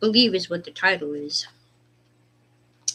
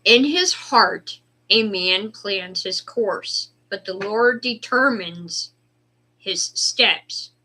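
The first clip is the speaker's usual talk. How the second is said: scripture reading; 6 dB louder, slower than usual. Acted. Reads scripture aloud.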